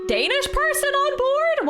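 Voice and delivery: incredulous high question; surprised. Real, not acted. Streamer amazed at one Danish sailor, incredulous. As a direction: pitch jumps way up in disbelief.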